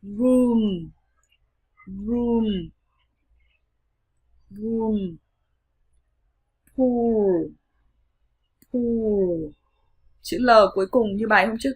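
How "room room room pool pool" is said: "Room" and "Pool" with the vowel drawn out, falling; long U drill. Shown as a demonstration. Teacher modelling practice words, "Room" and "Pool" said long and clear. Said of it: "luyện tập nói các từ sau".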